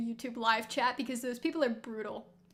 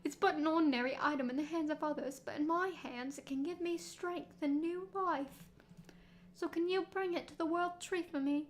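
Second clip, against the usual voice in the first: put-on sad Victorian child; 3 semitones higher than usual. Streamer voicing the sad Victorian child asking for help. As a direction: plead in a hammy British boy accent. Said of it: "this sad Victorian child".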